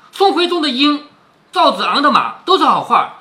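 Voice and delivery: storyteller voicing an angry comeback; acted indignant scolding. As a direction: throw out each phrase in mock indignation. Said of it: "鸳鸯也是愤怒到了极点".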